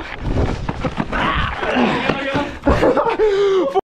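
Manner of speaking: breathless burst of laughter; laughing. Real, not acted. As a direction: laugh hard and long. Laughs after near fall.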